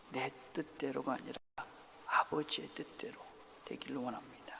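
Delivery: low, soft, near-whispered submission; surrendered prayer. Acted. Jesus surrendering to the Father's will, soft and low. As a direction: yield quietly, voice dropping away. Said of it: "주님께서 말씀하시죠".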